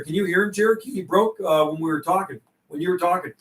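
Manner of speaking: usual speaking voice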